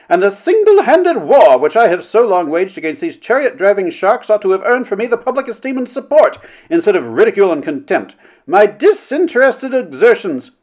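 high, haughty speechifying; self-important committee orator; indignant Mrs. Blank. A narrator's reading.

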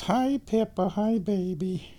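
high, soft cooing to a cat; high-pitched pet voice, drawn out at the end; pet talk. Real, not acted.

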